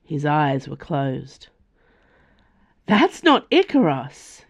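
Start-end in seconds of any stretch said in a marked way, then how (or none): 2.9-4.4 high, louder startled yelp. A narrator's reading. Nimue startled as a man drops onto the deck, yelping. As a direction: jump at the sight of the stranger. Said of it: "yelped Nimue".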